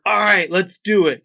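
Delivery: put-on loud, high, drunken all right let's do it; a tipsy guy blurting his eager yes. Drunk new friend agrees to a nipple tattoo, loud and eager. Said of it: "Completely drunk".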